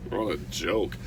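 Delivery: low, soft scoff with a little laugh. Retold or imitated. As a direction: dismiss it with a quiet laugh. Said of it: "and they're just like".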